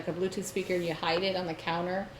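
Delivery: usual speaking voice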